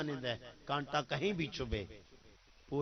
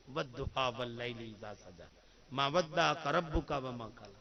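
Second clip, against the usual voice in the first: slow Quranic recitation; 4 semitones lower, slower than usual. Acted. Preacher recites Quran verses.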